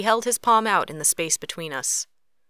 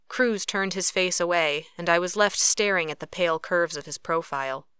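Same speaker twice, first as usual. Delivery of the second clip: usual speaking voice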